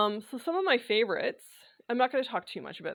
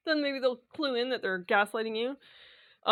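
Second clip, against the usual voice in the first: laughing; 4 semitones higher than usual. Real, not acted. Joking about gaslighters, laughing.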